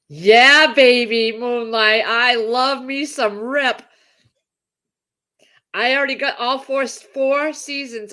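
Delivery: slow, loud fangirl gush; gushing fan. Real, not acted. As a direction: swoon over him out loud. Gushing over TV character.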